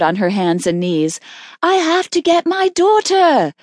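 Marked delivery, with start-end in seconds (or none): 1.6-3.5 high, desperate cry; panicked shriek. A narrator's reading. Mother scrambling to get her daughter, panicked. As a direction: plead frantically, voice pitched way up.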